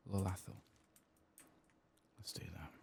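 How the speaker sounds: ordinary talk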